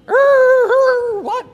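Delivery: high, loud startled screech; mock shock, shrieked high and loud; shocked outburst. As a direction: react like you nearly swerved off the road. Imagined shock while driving.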